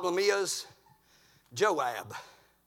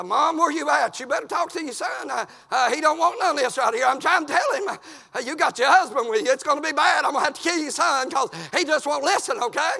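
high, raised plea to a parent; exasperated appeal; 3 semitones higher than usual. Acted. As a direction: call out for Mom's help in exasperation. Acted exasperated appeal to mother.

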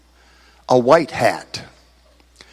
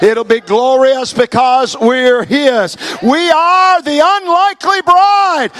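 climbing, shouting sermon climax, much higher and louder and slowing down; preaching at full force; 8 semitones higher, 4 dB louder, slower than usual. Acted. Preacher lifting the congregation toward heaven, rising to a full shout. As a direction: build from a strong call to a soaring, drawn-out cry of glory.

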